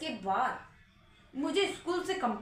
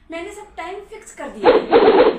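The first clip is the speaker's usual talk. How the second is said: insistent; 4 semitones higher, 18 dB louder than usual. Acted. Mother insists to tutor.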